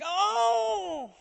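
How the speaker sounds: sudden high yelp of falling; startled cry. Retold or imitated. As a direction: imitate a startled gasp as you tip. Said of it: "there comes a point where you're like".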